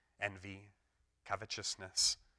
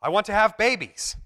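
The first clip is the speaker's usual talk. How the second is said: much higher, louder pleading; comic character declaring a wish, higher; high plaintive Stan; 9 semitones higher, 8 dB louder than usual. Retold or imitated.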